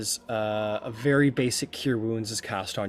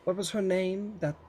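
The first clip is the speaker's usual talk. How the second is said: imperious goddess; 2 semitones higher than usual. Acted. Hera reminds Poseidon of his own past, pointed and haughty. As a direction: needle him with haughty questions.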